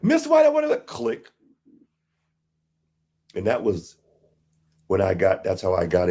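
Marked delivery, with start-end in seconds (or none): 0.0-1.3 much higher, squeaky woman's voice in disbelief; imitating Susan. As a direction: play the stunned employee on the phone.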